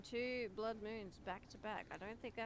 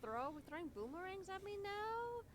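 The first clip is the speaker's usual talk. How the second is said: surprised indignation; 10 semitones higher, slower than usual. Real, not acted. Friend throws boomerangs at her.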